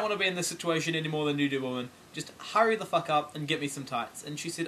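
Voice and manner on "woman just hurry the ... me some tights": normal talking voice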